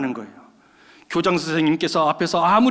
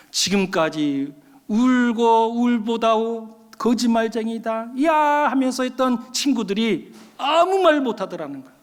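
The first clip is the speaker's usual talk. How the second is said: normal talking voice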